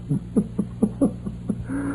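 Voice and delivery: breathless mad giggle; unhinged breathy laugh. Acted. Thirst-crazed miner clutching the tea, laughing madly. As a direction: laugh like he's lost his mind.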